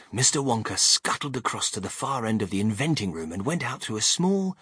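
ordinary delivery, nothing marked

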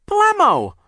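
put-on very high, loud baby shout; baby sister shouting a nonsense word; baby cry. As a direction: squeal it like a baby. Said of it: "Sonny cried".

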